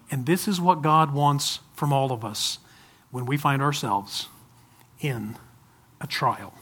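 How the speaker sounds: hushed closing; fading softly. Acted. Fading soft conclusion.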